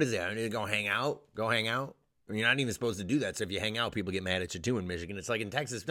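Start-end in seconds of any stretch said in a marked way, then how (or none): none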